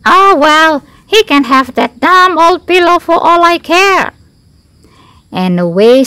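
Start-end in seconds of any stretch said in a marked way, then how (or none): 0.1-4.1 high, loud huffy grumble; huffy, dismissive bird, pitched high; grumbling. A narrator's reading. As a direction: grumble like a put-out crow. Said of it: "Crow grumbled as he hovered".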